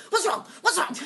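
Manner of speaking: high, loud panicky repeats; panicked son shouting. Retold or imitated. Son shouting from the background in panic, high and loud. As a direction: call out in alarm like a scared boy. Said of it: "Jake is in the background going".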